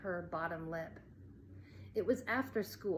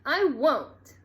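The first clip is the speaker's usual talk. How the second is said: loud, high angry retort; 7 semitones higher, 11 dB louder than usual. A narrator's reading. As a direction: spit it back in fury. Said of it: "Colleen exploded".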